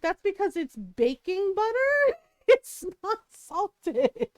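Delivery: retold exasperation breaking into a laugh; exasperated parent. Parent to child eating baking butter, exasperated.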